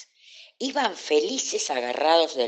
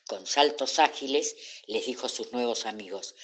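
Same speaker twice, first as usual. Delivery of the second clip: ordinary delivery, nothing marked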